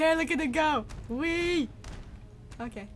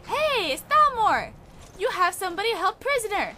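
loud, high call at the guards; 8 semitones higher, 8 dB louder than usual. Real, not acted. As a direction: shout over to get their attention. Calling out to Thalmor guards.

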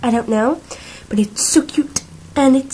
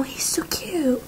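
whisper; 4 semitones higher, 9 dB quieter than usual. Real, not acted. Cooing over toy pet.

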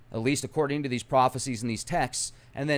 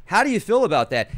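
normal talking voice

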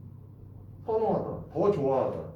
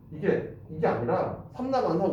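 ordinary talk

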